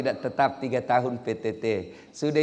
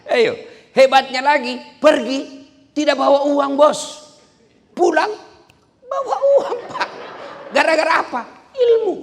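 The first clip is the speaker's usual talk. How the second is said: excited boast; 12 semitones higher, 9 dB louder than usual. Real, not acted. Preacher boasting, rising excitement.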